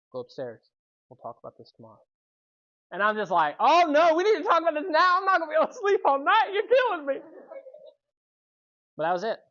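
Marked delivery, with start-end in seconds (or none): 0.1-2.0 calm dad. Retold or imitated. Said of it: "My dad looks at me and he goes". 3.6-7.2 high, loud, fast frantic begging; panicked pleading. Retold or imitated. Son begging his dad to talk now, loud frantic pleading.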